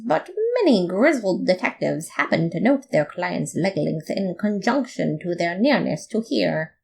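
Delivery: ordinary delivery, nothing marked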